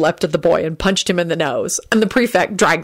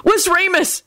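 high-pitched, urgent cry; frantic shout; 14 semitones higher, slower than usual. A narrator's reading. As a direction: call out in a frantic panic. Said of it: "he shouted frantically".

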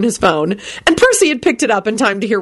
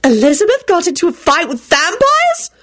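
very high alarmed disbelief rising; shocked shriek; 12 semitones higher than usual. A narrator's reading. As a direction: shriek the question in horror. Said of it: "shrieked Solange".

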